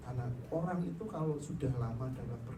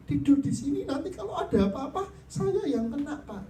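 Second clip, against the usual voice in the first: scolding nurse; 10 semitones higher, 4 dB louder than usual. Retold or imitated.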